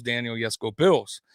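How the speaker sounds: ordinary delivery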